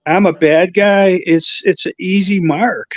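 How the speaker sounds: ordinary talk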